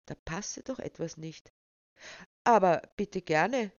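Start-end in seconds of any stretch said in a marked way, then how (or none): 2.4-3.7 breezy, confident invitation; casually obliging; relaxed. A narrator's reading. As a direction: offer it loudly and readily. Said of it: "hörte Sophie Zoe entspannt sagen".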